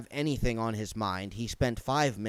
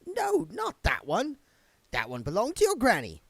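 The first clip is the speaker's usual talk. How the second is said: put-on higher, resigned old woman's voice; grudging housewife; 11 semitones higher than usual. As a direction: give in with a sigh.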